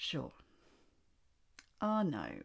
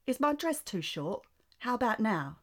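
high, loud posh British voice; [name] impression; 4 semitones higher, 4 dB louder than usual. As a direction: play a pouty posh British pop star. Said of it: "I can do a [name] impression".

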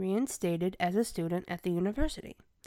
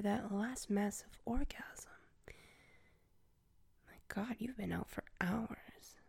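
soft mocking whisper; 7 dB quieter than usual. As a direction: whisper to wake them. Waking captive softly.

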